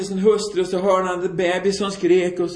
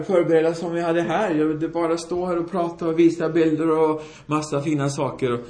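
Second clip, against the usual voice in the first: normal talking voice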